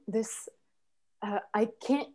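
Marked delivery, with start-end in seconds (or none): none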